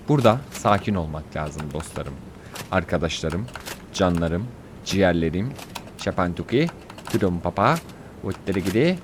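very low, measured voice; deep, controlled mock-serene delivery; mock calm. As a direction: drop low and force yourself calm. Forces calm, drifts into nonsense words.

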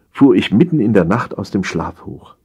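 usual speaking voice

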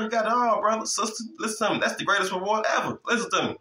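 preaching at full force; fervent preaching. Acted. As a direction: lift the congregation up. Preaching builds in pitch.

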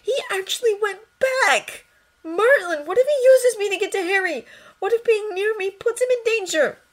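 high, loud, frantic fear; boy panicking about his father; panicked. Acted. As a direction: spill out the panic through tears.

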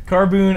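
mock Carboon; Carboon mispronounced. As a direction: repeat Carboon with a stretched oo. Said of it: "he says Carboon".

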